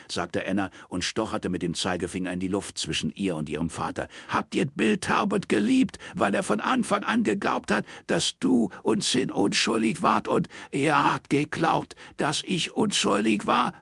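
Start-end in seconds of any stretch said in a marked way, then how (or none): 4.3-13.7 bellowing. Acted. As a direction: roar the accusation. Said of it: "brüllte Roy".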